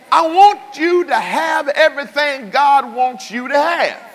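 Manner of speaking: high, loud preaching climb; full-force preaching. Acted. Preacher pleading with the congregation, high and loud. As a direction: preach it out to the whole church.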